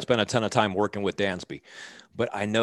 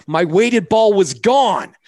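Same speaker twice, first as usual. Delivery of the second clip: pitched up and loud, slow and dismayed; hammed-up dismay, much higher and louder; mock panic; 9 semitones higher, 9 dB louder, much slower than usual. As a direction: act out the shock of the missing ball. Panicked player retelling lost ball.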